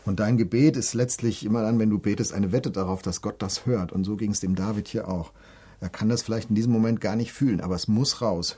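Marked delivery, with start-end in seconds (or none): none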